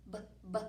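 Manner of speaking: short repeated b, b; isolated b sound. Shown as a demonstration. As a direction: tap out the b sound twice. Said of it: "B is the first sound".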